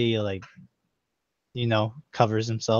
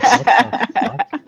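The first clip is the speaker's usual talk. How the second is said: high, loud laughing shock; shocked high outburst; 10 semitones higher, 6 dB louder, much slower than usual. Acted. Frederick sees his own naked double, shrieks in shock. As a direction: shriek it in disbelief. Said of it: "He's like".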